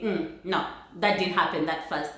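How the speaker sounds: normal talking voice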